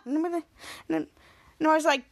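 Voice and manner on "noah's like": usual speaking voice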